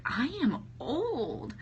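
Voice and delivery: high, slow, drawn-out realization; mock dismay. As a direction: stretch each word in swooping comic dismay. Mock dismay at twenty years.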